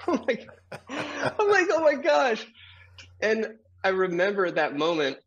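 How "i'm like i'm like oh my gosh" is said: laughing disbelief. Retold or imitated. Laughing at his own retold shock.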